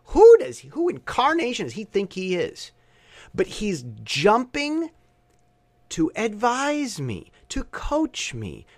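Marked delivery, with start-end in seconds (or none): 0.1-2.7 sudden high, loud burst of indignation, then dropping back; indignant outburst. Real, not acted. Fuming about a critic who never did his homework, indignant outburst. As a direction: blurt it out in disbelief at his nerve.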